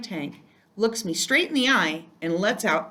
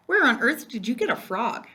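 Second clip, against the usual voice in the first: husband's voice; 5 semitones higher than usual. Acted. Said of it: "Mister Brisbane leans in close".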